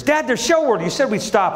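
put-on high, eager kid voice pointing something out; a little daughter spotting the stop she was promised; child reminding her dad he promised; excited little girl. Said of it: "Gracie goes".

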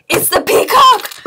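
high, loud, drawn-out cry of joy; excited. Real, not acted. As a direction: shriek it like you just won. Finds the rare peacock toy.

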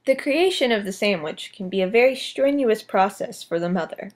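hushed wildlife documentary narrator; nature documentary. Acted. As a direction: narrate like a nature film host.

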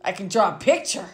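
usual speaking voice

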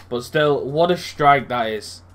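ordinary talk

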